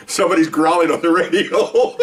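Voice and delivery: real laughter breaking into the words; laughing. Real, not acted. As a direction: barely get it out before cracking up. Cracks up telling his story.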